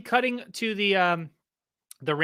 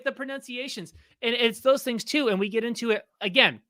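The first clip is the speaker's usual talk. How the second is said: normal talking voice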